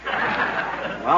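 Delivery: usual speaking voice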